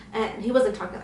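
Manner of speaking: ordinary delivery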